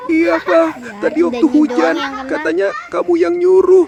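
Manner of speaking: high, tearful wife voice; sobbing. A narrator's reading. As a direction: complain through sobs. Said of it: "sambil terus sesenggukan". (istri mengadu sambil sesenggukan)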